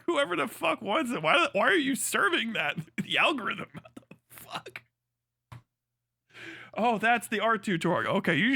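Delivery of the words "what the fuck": whispered slowly in disbelief; slow stunned whisper. Real, not acted. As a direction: breathe it out, aghast. Stunned at the feed.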